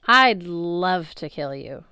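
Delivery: lightly voiced menacing god of war. A narrator's reading. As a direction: savour the threat like a bully. Ares menacing Percy.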